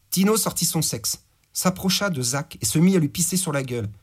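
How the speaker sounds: ordinary delivery, nothing marked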